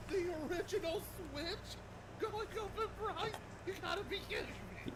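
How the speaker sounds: rising high, incredulous; outraged disbelief. Real, not acted. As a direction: ask it in total disbelief. Outraged disbelief at price hike.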